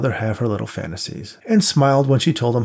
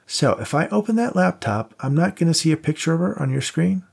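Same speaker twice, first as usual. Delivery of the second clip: a hint of a higher, teasing pal; lightly voiced buddy; 7 semitones higher than usual. A narrator's reading. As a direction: play the cocky friend lightly. Voiced friend Max.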